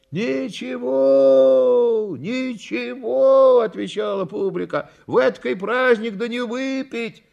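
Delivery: easy, high-pitched crowd remarks; crowd voices. A narrator's reading. Audience excusing drink on a holiday, indulgent.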